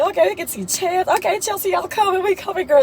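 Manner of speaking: put-on giddy teen telling her friend they're all coming; excited squeal. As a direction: gush it like a teen who just got her way. Her own excited reply retold.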